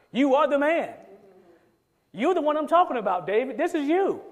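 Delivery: jab the word are right at him; accusing. Acted. Nathan confronting David to his face, pointed accusation. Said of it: "Nathan said to David".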